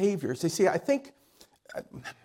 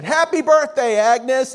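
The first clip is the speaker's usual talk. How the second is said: loud, high birthday cheer; surprise shout; 10 semitones higher, 9 dB louder than usual. Retold or imitated. Friends greeting Agnes at the door with a loud, high cheer. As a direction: yell it out as she walks in.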